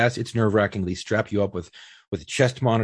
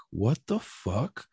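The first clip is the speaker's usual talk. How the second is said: slow, quiet what the fuck; stunned disbelief; 7 dB quieter, much slower than usual. Retold or imitated. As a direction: let it drop out, stunned. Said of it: "And I was like".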